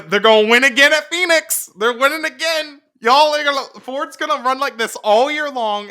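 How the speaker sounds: loud, high, worked-up prediction; excited. Real, not acted. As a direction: get fired up and loud. Excited rant about Ford winning.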